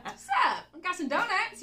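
loud, high, hammed-up greeting; chummy coworker. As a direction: burst in announcing treats. Acts chatty coworker bringing snacks.

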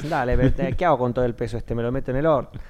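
loud, high, exasperated inner voice thanking him for the burden; sarcastic mock gratitude. Actor mocking the director's charge with loud sarcasm. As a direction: throw back a bitter, loud "thanks a lot". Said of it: "Y era como".

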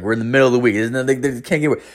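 usual speaking voice

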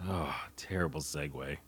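whispered under his breath. Real, not acted. Podcaster mocking his own clumsy segue, whispering. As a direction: mutter it as a private aside.

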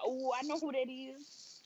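soft, slow, drawn-out realisation; imitated knowing gossip. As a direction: quote nosy listeners catching on. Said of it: "they gonna be like".

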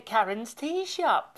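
ordinary delivery

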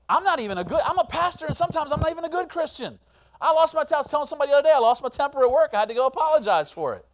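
high, loud, fast preaching; revved-up pulpit voice; fervent preaching. Real, not acted. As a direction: drive it home with fire. Preaching at full force.

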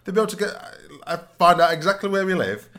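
high-pitched, loud comic outburst with laughter; loud, squealing mock outrage; mock alarm. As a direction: exaggerate the panic, voice shooting up high. Mock alarm at sharing personal details.

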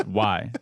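American "why"; "why" with an open American vowel; corrected "why". Shown as a demonstration. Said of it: "correcting you saying why".

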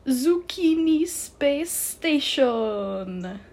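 put-on loud, high, very slow French-accented proclamation; outrageous French accent. A narrator's reading. The bow revealing its name, triumphant French accent. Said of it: "outrageous and completely unnecessary French accent".

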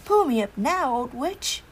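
calling up. Acted. Soldier calls up the tree.

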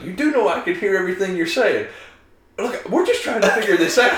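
high amused retelling; laughing retold line.

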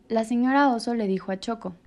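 ordinary delivery

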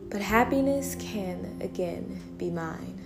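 usual speaking voice

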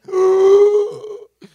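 loud high gasp of alarm; hammed-up startled intake of breath; shocked gasp. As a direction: gasp as if caught off guard. Told to preach with no warning.

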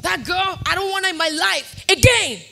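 mild heated refusal; retold angry vow. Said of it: "And then I was saying".

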